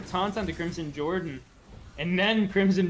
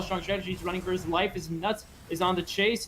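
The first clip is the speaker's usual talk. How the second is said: usual speaking voice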